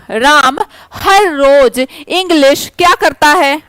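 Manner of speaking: normal talking voice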